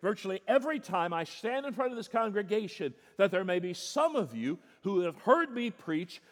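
high, loud pulpit preaching building to a cry; fervent preaching. Acted. Preacher warning his congregation, at full force.

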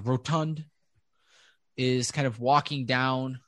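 normal talking voice

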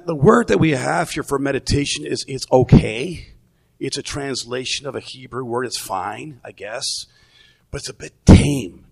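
ordinary delivery

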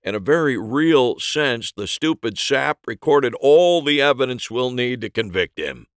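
forceful, loud cop voice; a detective laying out evidence. Acted.

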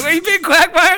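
high, loud cartoon neighbor call. Friend voices a neighbor greeting Quagmire, high cartoon call.

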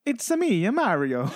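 Mario voice. Shown as a demonstration. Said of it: "Can you do a Mario?".

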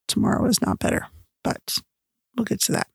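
breathless, hushed spoiler; a quick conspiratorial whisper; whispered aside. Real, not acted.

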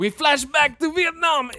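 high slow hammed-up line; crazed director. Director announcing scene, hammed-up high.

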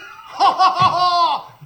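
loud hooting cackle; loud mocking laugh. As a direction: cackle like the pranksters. Callers laughing at prank.

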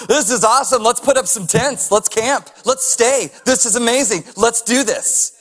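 high, loud, laughing rush of words; giddy rambling Peter. Retold or imitated. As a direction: babble on like an overexcited camper. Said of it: "he just starts talking".

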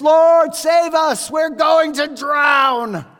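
high, loud, desperate cry for help; terrified disciples yelling at Jesus; panicked shout. Retold or imitated. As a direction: scream it over the storm. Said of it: "they were a bit excited".

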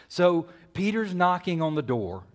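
usual speaking voice

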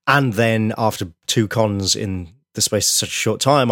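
usual speaking voice

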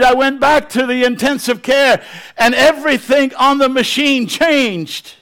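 high, loud, climactic sermon delivery; full-force preaching. Acted. Preacher telling the congregation of a healing, at full force. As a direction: drive the testimony to a shouting peak.

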